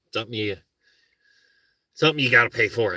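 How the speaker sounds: ordinary delivery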